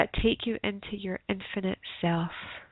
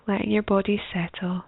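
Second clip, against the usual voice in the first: guided meditation. Acted. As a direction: ease them gently into stillness.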